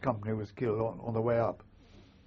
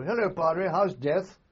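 bright, louder, higher cheery hello; cheerful greeting; 6 semitones higher, 6 dB louder, slower than usual. Retold or imitated. Officer greeting the padre, cheerful and louder. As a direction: hail the padre like an old friend. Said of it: "very cheerful".

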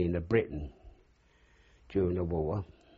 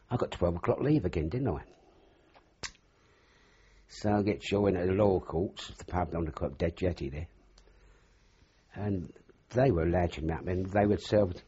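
usual speaking voice

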